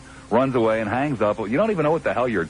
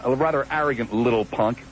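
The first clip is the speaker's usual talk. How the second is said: ordinary talk, nothing marked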